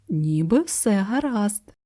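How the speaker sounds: ordinary delivery, nothing marked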